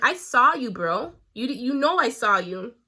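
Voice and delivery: loud "I saw you, bro"; loud confrontation. Retold or imitated. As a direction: call her out, loud and angry. Confronting the girl.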